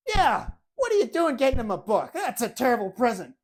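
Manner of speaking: loud, high indignant father; dad scolding. Retold or imitated. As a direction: rant at the son over the bad gift. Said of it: "And then he looks at me".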